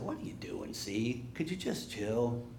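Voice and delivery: soft, low, casual soothing; Jesus. Acted. Jesus asking the raging sea to settle, softly. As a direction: talk it down without any effort.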